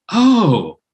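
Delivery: high, falling "oh"; sudden realization. Retold or imitated. Actor watches the director walk off, high-pitched dawning surprise.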